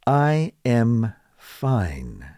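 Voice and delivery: slow, every word separate, I am fine; teacher's slow uncontracted I am fine; slow full form. Shown as a demonstration. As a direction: say I am fine slowly without contracting. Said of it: "You're contracting it again".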